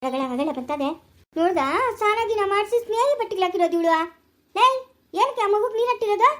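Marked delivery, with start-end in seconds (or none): 1.3-6.3 high-pitched, indignant scolding; angry scolding. Acted. Scolding over the child's bath and clothes, angry. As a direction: demand an explanation, pitched right up.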